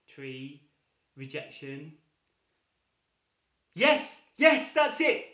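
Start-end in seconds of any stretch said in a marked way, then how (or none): 3.7-5.3 yes yes, much higher and louder; excited. Real, not acted. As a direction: cheer them on for the right answer. Audience guessed right.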